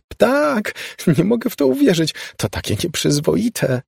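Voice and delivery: high giddy squealing; high mock-shocked squeal. A narrator's reading. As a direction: squeal in scandalised delight. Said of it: "piszczała Molly".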